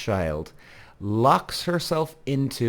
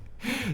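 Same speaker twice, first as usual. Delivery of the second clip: a brief high laugh; short laugh; 12 semitones higher, 8 dB quieter than usual. Real, not acted. Recalling her locked in the bathroom, a brief laugh. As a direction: laugh at how absurd it was.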